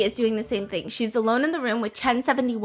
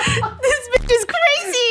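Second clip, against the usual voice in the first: high, slow, hammed-up dismay; comically freaked out; mock alarm; 12 semitones higher, slower than usual. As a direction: squeal it slowly, barely holding back laughter. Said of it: "He looks concerned".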